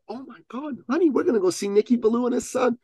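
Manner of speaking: high, quick motherly squeal; imitated mother. Mother telling her little son, high imitated voice. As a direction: gush it in a put-on woman's voice. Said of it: "And I went".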